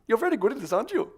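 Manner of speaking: high, loud German teacher; mock German accent. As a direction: quip in a put-on German accent. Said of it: "everyone thought I was a German".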